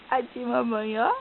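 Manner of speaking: low, lightly voiced baby reply; voicing the baby. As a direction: answer for the baby, softly. Mother answers for the baby.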